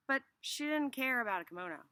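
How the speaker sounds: ordinary delivery, nothing marked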